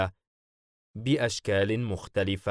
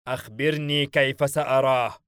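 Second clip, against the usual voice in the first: raised, louder furious demand; exploding anger; 5 semitones higher, 5 dB louder than usual. A narrator's reading. Enraged demon insisting on seeing the prisoner, angry. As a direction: press him hard, temper bursting. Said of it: "بيلفيغور والغضب يتفجر من عينيه".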